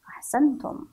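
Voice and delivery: normal talking voice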